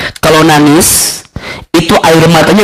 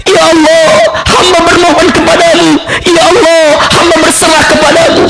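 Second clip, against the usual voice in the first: tearful prayer; 12 semitones higher than usual. Retold or imitated. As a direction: plead to God as if near tears. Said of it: "dia menangis sambil berkata". (doa memohon dengan suara tinggi memelas)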